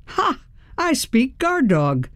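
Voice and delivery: high, gloating little "Ha!"; triumphant. A narrator's reading. Narrator to the silenced coyotes, triumphant boast. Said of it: "Impressed with my victory".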